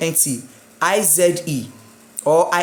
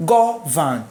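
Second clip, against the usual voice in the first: "Govern" with GO punched, then falling; stress on first syllable. Shown as a demonstration. Teacher answers which syllable is stressed, "Govern" stressed on go. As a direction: split "Govern" in two, leaning on go. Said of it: "My stress on the first syllable, which is go".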